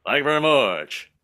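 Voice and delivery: slurred impersonator's sign-off. Acted. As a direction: mumble it with the King's Southern curl. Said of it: "every [name] impersonator I've ever seen".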